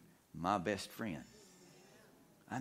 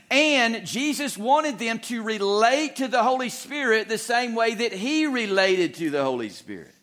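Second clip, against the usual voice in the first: loud, higher, driving sermon voice; full-force preaching; 13 semitones higher, 11 dB louder than usual. Acted. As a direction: preach it with rising fervor.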